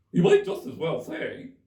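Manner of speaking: high, loud hare voice; March Hare voice. Acted. March Hare questioning Alice, high and loud.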